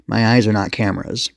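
ordinary delivery

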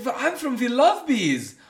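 high, loud, drawn-out mock Germanic accent; butchered European accent. Doctor joking with patient about bees, exaggerated European accent. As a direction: ham up the Eastern European doctor, slowly. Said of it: "I'm just gonna keep doing it".

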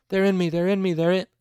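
chanted terrified shriek, high and loud; panicked screaming. A narrator's reading. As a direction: repeat it like a mindless chant. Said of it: "the screaming continued in an unbroken chant".

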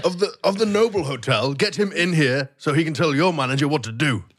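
haughty demand; indignant guest. Acted. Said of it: "the receptionist an earful".

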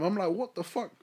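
ordinary talk

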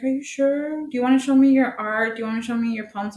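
high, overfriendly imitation; coaxing lady. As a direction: mockingly imitate someone coaxing a child.

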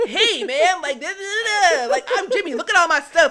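loud, high, hyped-up hustler voice; Jimmy the scammer. Acted. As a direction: oversell it like a manic show-off. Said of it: "we see Jimmy the scammer come out".

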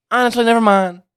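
lightly mimicking [name]. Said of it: "I thought it was about to be like".

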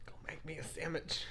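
whispered mock order; hushed, breathy parody of an order. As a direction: whisper it as a joking husband's command. Mocking fifties husband's order.

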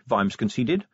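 usual speaking voice

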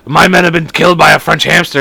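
loud, put-on leader's voice; Putin. Putin phoning with grave news, loud put-on voice. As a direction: announce it like an outraged head of state. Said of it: "Putin calls and is like".

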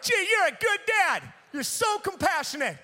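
high, loud mock gratitude; over-the-top retold sarcasm; sarcastic praise. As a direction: gush sarcastically at your dad. Sarcastic praise of prankster dad.

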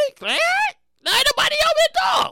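usual speaking voice

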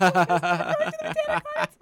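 mock excited. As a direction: announce it giddily through laughter. Acts out announcing garden trip, laughing.

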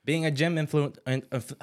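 stumbling and stuttering mid-word; real stutter, tongue-tied on a long word. Real, not acted. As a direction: trip over the word and stall. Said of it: "I stuttered".

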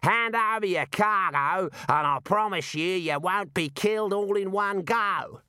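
high, slow, jolly call; gleeful raider calling across to the victim; cheerful shouted threat. A narrator's reading. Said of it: "he shouted cheerfully".

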